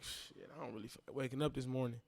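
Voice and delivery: soft, unwilling morning grumble; groggy reluctance. Retold or imitated. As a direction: say it like you'd rather stay in bed. Reluctant inner thought.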